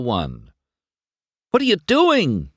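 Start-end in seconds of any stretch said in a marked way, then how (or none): 1.6-2.5 high, loud shout; alarmed shout. A narrator's reading. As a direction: yell at the swerving truck. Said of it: "McQueen shouted".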